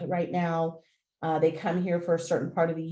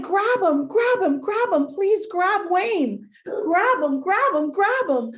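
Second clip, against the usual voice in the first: much higher, louder, frantic begging; desperate pleading; 11 semitones higher, 7 dB louder, slower than usual. Retold or imitated. Owner begging the stranger to stop Wayne, frantic and high. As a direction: beg him to save the dog, frantic. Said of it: "And I said".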